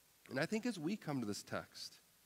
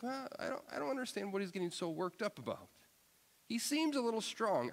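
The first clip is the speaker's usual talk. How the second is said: mock puzzled listener; 8 semitones higher than usual. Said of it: "we're like".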